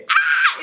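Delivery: short high squeal; excited scream. Real, not acted. Reader spotting a character in the excerpt, excited scream. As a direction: shriek with fangirl excitement. Thrilled at who appears in excerpt.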